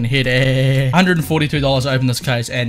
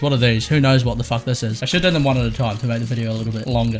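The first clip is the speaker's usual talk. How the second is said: normal talking voice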